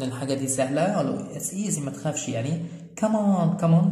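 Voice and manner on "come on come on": a little higher, cheering someone on; encouraging call. Shown as a demonstration. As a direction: urge them on with a lift. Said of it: "بتستخدم للتشجيع".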